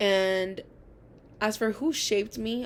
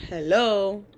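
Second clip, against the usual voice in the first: sarcastic hello; 7 dB louder than usual. Retold or imitated. Mock hello to a student.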